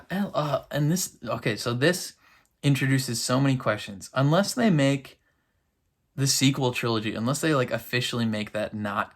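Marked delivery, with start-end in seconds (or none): none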